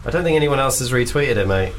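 normal talking voice

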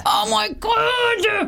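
very drawn-out high cry; hammed-up amazement, stretched right out; thrilled exclamation. Thrilled by the photo shoot idea.

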